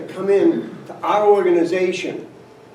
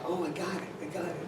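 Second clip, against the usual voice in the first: hushed understanding; 3 semitones lower, 19 dB quieter, faster than usual. Retold or imitated.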